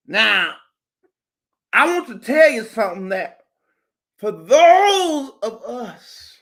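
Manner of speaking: booming sermon that drops off at the end; preaching at full force. Acted. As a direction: work the crowd like a preacher. I feel like preaching up in here.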